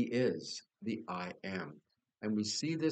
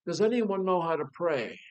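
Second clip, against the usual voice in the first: a high, loud shout across a stormy deck; captain yelling; 8 semitones higher, 7 dB louder than usual. Retold or imitated. Captain in a storm yells to his crew, loud shout. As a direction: call out to the whole crew over the storm. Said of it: "the captain yells out to the crew".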